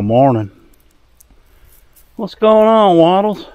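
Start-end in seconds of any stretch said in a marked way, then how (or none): 2.1-3.5 high, sing-song voice for the rooster; raised pet-talk voice for Waddles. Real, not acted. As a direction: coo up at the bird like a pet. Talking to his rooster Waddles.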